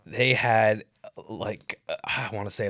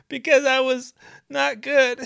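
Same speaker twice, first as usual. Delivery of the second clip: much higher, louder whiny plea; whiny defendant; 14 semitones higher, 8 dB louder than usual. Pretend. Defendant pleading before the peers, high whiny plea. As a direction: plead your case pathetically. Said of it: "Why'd you go to therapy?".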